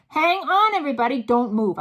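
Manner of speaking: loud, high urgent command; crying out. A narrator's reading. Mildred ordering the witches to stay still, loud. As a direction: freeze everyone with it. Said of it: "cried the witch called Mildred".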